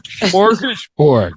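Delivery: Smorgasborg drawn out slowly as "kmorgishpor"; mocking pronunciation. Host mocking the guest's attempt, slow mock pronunciation. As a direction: mimic her garbled Smorgasborg. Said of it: "Now you're making fun of me".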